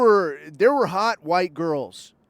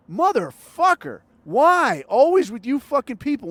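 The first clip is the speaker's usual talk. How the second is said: higher, louder mock-exasperated cry of disgust; exasperated outburst; 6 semitones higher, 4 dB louder than usual. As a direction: throw up your hands and groan it out. Exasperated rant at stingy client.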